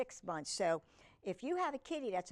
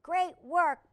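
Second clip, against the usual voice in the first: higher, louder cheerful praise; Tooth Fairy praising; 8 semitones higher, 7 dB louder than usual. Acted. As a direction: cheer Pete on. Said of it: "says the Tooth Fairy".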